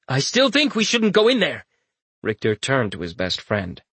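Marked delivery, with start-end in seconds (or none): 0.1-1.6 desperate. Acted. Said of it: "a touch of desperation in it".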